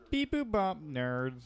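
robot voice. Retold or imitated. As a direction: beep it out like a robot. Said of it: "talks like a robot".

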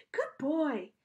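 high, encouraging doggy voice; praising a dog. A narrator's reading.

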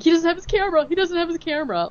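high, frantic repeat; mock panic. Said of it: "they're like".

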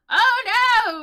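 high, loud cry of dismay. Real, not acted. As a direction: cry out in alarmed disappointment. Dismayed at missing romance option.

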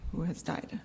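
holding back real tears; choked near-whisper. Real, not acted. Grieving granddaughter telling of her grandfather's death, choked whisper. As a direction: voice catching, almost silent. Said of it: "I'm not normally a crier".

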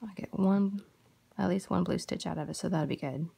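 ordinary talk, nothing marked

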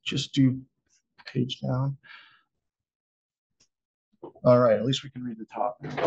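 normal talking voice

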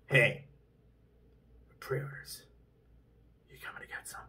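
hushed, taunting whisper of a sales pitch; mock teasing whisper. Vlogger mocks a GameStop tweet in a teasing whisper. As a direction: whisper it like a sly tease. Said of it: "GameStop tweeted out and said".

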